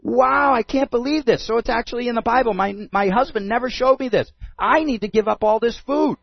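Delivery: amazed. Retold or imitated. Woman amazed learning the diet.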